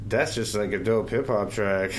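ordinary delivery

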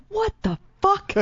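high, drawn-out disbelieving freak-out. Retold or imitated. Woman reacting to talk of ghosts, freaking out. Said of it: "like, freaking out".

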